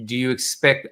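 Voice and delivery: usual speaking voice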